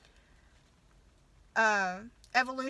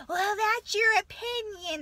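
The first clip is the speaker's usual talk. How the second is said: mocking principal; 7 semitones higher, slower than usual. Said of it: "the principal".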